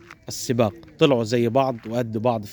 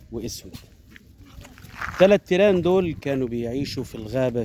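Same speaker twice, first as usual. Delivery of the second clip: arabic grandma folk tale; 2 semitones lower, 3 dB quieter than usual. A narrator's reading. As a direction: tell it like a grandmother at bedtime. Folk tale opening narration.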